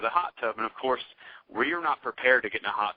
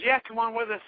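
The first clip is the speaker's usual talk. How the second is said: higher, lightly voiced girl inviting them along; a light imitation of the girl, pitched up; mock girl voice; 10 semitones higher than usual. Retelling how the girl invited them along, a lightly higher mock voice.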